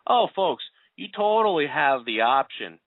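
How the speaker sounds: clumsy stab at Boston speech; attempted Boston accent. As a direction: quote a politician in a hammy Boston accent. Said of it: "do my best Boston accent".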